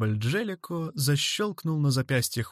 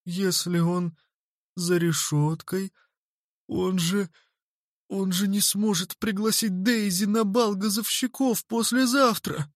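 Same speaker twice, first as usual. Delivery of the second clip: dawning dismay; 7 semitones higher than usual. A narrator's reading. Boy realises his bet is lost.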